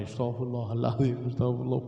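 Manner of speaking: low, even recitation of istighfar; murmured dhikr. Acted. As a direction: repeat it evenly on a low note. Husband recites in front of wife.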